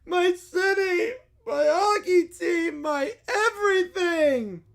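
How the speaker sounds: high, slow wailing sob voice; mock sobbing little boy; crying child. A narrator's reading. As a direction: wail it like a crushed kid. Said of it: "crying so hard that puddles are forming".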